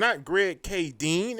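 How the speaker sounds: Greg K Dean slow and drawn out; mock mispronunciation. Correcting a misread name, slowly mocking it. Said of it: "it's Greg Caton".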